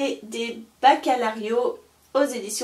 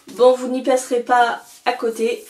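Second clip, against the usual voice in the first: normal talking voice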